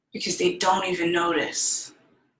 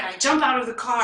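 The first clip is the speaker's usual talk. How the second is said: ordinary talk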